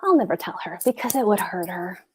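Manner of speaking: hushed confiding whisper. Acted. Theo whispers his secret to Gertrude.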